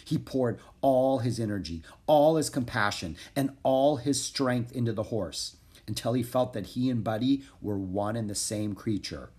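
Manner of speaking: plain reading voice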